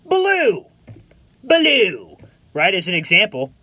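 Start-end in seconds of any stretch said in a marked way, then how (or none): 0.0-2.2 higher, firm, certain "blue"; confident answer. Shown as a demonstration. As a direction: say it like you know it's right. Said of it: "you just confidently say".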